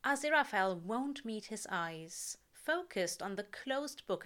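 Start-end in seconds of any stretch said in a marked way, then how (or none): none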